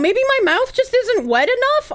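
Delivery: ordinary talk, nothing marked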